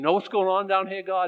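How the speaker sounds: hammed-up news report to heaven; mock informing prayer. Praying to give God information, mock earnest. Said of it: "We have to pray and, and give him information".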